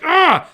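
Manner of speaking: sharp high yelp of dismay; frustrated cry. Pretend. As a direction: let out a short loud cry of disappointment. Frustrated at finding no ding.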